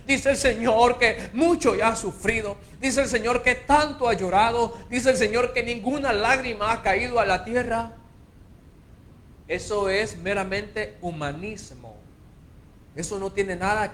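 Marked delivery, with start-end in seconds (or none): 0.1-7.9 mock prophet. Mocking prophets again.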